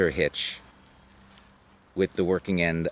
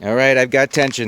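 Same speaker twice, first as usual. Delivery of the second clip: calling out; 6 semitones higher, 9 dB louder, faster than usual. Real, not acted. Calling out to belayer.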